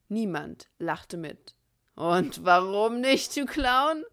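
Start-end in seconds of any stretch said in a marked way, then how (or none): 2.0-4.0 loud, slow sneer; menacing taunt. A narrator's reading. Bill sneering at Andy with gun drawn, loud. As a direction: spit the insult at him.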